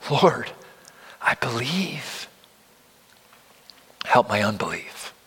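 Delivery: a mild imitation of a father praying through tears; tearful plea. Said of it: "he says with tears".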